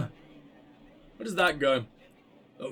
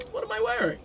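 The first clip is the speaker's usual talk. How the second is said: squeaky high voice; faster than usual. Pretend. Same question repeated in squeaky voice.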